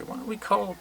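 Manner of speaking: lightly voiced inner thought, high and hushed. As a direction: float the idea up high like a daydream. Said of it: "I kinda thought, like".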